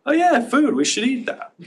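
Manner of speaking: high, dopey sudden recall; dog's brain remembering. Retold or imitated. Dog's brain suddenly remembering food, high and goofy. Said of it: "the brain went".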